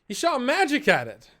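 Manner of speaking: high, loud, breathless witness; excited townsperson. Acted. Villager telling the crowd about Riser's magic, high and excited. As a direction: point and blurt it out. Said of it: "he goes".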